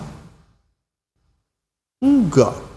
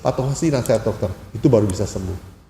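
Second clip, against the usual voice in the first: normal talking voice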